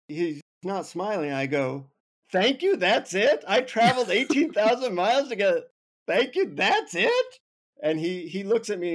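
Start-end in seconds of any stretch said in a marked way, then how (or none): 2.3-5.6 high, loud disbelieving protest; incredulous outburst. Retold or imitated. Traveler protests to guru after eighteen thousand miles, loud and incredulous. 6.1-7.4 high, loud disbelief, leaping up at the end; incredulous outburst. Retold or imitated. Disappointed traveler questions the guru's mantra, loud and incredulous. Acted indignant outburst at the guru.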